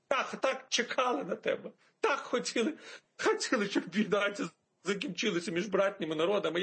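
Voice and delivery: high, sentimental mock welcome; mock tearful Putin. Putin embracing Zelensky over ending the war, mock tearful. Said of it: "А Путін обійняв його і прослизився".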